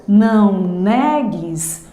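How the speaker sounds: "Não negues" slowly, NE drawn out as the last beat; stress on NE. Shown as a demonstration. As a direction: scan "Não negues", leaning on NE. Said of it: "a última é o ne".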